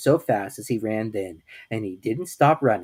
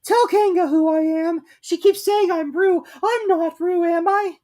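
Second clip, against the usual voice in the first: pleading squeaky Piglet; 7 dB louder than usual. A narrator's reading.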